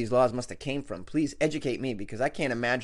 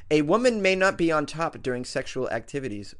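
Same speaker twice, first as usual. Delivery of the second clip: ordinary delivery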